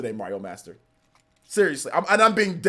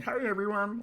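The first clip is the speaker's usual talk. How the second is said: soft put-on streamer hello; put-on greeting voice; 12 dB quieter than usual. Shown as a demonstration. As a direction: do a quiet silly-voiced greeting. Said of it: "the voice when I was going".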